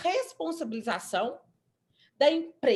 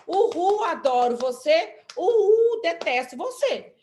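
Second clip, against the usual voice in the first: mock cheer with louder, higher whoops; torcida imitada; 4 semitones higher, 6 dB louder, slower than usual. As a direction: whoop it out like a rally supporter. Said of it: "Eu posso chegar e falar". (imitando gritos de torcida)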